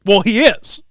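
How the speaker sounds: hammed-up huffy comeback; mock flipped out. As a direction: imitate someone snapping back, high and loud. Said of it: "she just flipped".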